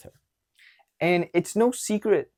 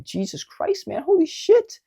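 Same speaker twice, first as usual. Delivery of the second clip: startled; 3 dB louder, slower than usual. Retold or imitated. Startled by frantic stranger.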